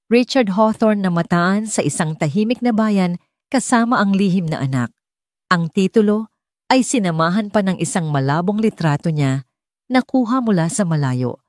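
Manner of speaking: ordinary delivery, nothing marked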